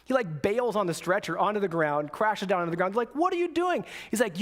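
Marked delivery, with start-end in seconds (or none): 3.1-3.8 high-pitched retold exclamation of disbelief; incredulous outcry. Buddies to Doss as he rolls off the stretcher, incredulous.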